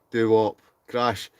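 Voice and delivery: usual speaking voice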